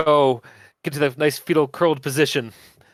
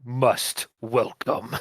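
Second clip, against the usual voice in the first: low grumbling beast voice; grumbling creature; 4 semitones lower, 7 dB quieter, much slower than usual. Acted. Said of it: "grumbles out".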